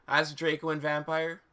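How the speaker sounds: usual speaking voice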